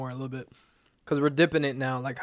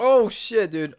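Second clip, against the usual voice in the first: shocked; 7 semitones higher, 6 dB louder, slower than usual. Real, not acted. Hot sauce burning.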